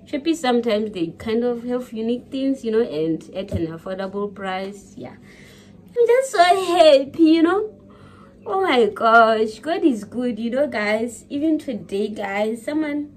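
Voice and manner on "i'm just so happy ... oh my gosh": slow, high, louder, voice catching with happy tears; overwhelmed with gratitude, close to crying; tearful joy. Real, not acted. Vlogger thanking friends for gifts, tearful and overjoyed.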